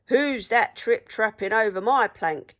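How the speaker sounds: loud mocking taunt; jeering. A narrator's reading. Pirate mocking the troll on the plank, jeering. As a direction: sneer it like a bully. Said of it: "jeered Handkerchief".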